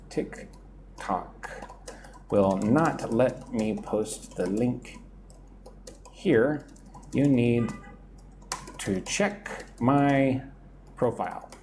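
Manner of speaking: slow, word-by-word delivery with pauses; slow, careful repeat. Real, not acted. Teacher repeats the link rule for a student, slowly and carefully. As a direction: spell it out slowly for a learner.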